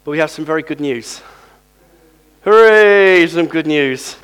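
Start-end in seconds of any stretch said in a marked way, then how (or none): 2.4-4.2 jubilant cheer. Real, not acted. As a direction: cheer it out loudly. Cheering the good news.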